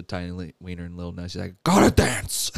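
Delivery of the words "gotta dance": high, loud exclamation; excited outburst. Retold or imitated. Pistachio announces that he must dance, excited outburst. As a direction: burst out with glee.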